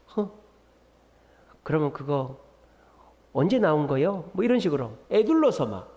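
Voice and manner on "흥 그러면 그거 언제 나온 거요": Chungcheong dialect. Retold or imitated. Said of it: "그 충청도 분들이 뭐 그런다지 않습니까?".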